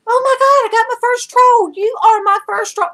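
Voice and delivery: very high, loud delighted squeal; giddy retold celebration; so excited. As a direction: shriek it with over-the-top glee. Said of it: "I was so excited".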